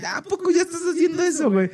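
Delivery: mocking high whiny jab; envidioso burlón. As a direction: mimic a jealous sneering guy. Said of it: "el pinche acomplejado, güey, que te dice". (imitando burlón a un envidioso)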